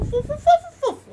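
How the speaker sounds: very high, loud cooing fufu noise; cutesy squeal. Retold or imitated. Narrator mimics the couple cuddling, cutesy high squeal. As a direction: make squeaky lovey-dovey babbling.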